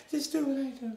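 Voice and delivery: normal talking voice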